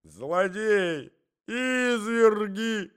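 high, drawn-out melodramatic wail; lady's cries. A narrator's reading. Lady collapsing onto the couch, crying out. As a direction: cry out loud, stretching the last word. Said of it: "кричала дама".

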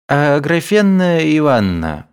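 high, hesitant call; timid. A narrator's reading. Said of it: "робко сказал Евсей". (робко)

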